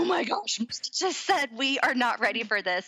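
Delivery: high-pitched panic, easing as it goes; retold freak-out. Wife realizes husband said they weren't ready, retold panic. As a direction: replay your own "oh my gosh" panic. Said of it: "I am freaking out".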